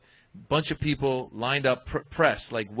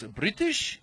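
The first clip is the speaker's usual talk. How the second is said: high, rising one-word query; lightly acted official, pitched up; questioning passport officer; 8 semitones higher than usual. Officer checking a traveler's passport, questioning.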